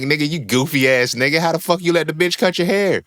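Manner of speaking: a mock scolding of Samson; mocking disbelief. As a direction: scoff at him like a fool. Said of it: "we be looking at Samson like".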